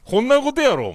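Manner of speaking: high, punchy Hakata dialect. Shown as a demonstration. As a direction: belt out emphatic Hakata Japanese. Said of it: "博多弁で行けよ".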